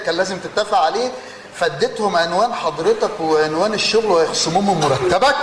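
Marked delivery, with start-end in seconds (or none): none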